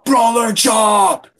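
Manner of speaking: high, loud, slow war cry; battle shout. Acted. The Brawler shouting his attack at Jay. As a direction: roar it out with all his might. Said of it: "with all his might shouted".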